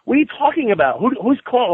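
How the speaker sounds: a high mocking rant of outrage; incredulous outrage. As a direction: shout your disbelief at him. Retold wife's outrage at the band.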